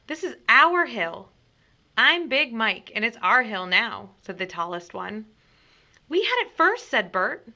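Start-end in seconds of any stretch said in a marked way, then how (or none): 0.1-4.1 put-on defiant loud kids. A narrator's reading. Rival kids claiming the hill, loud and defiant. 6.1-6.9 very high, loud whine; indignant protest. A narrator's reading. Bert protesting to Big Mike, high and loud.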